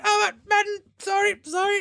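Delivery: high, flustered apology; acted high-pitched fluster; flustered guard. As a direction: play an embarrassed guard apologising. Guard bursts in on undressed women.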